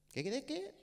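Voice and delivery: bewildered pet shop clerk; puzzled clerk. Retold or imitated. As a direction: act confused, can't understand. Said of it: "shop clerk".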